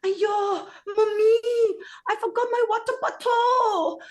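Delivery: high, loud imitation of a three-year-old in Singapore English; daughter's Singapore English. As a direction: squeal it like a toddler copying her friends. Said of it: "walks in and says".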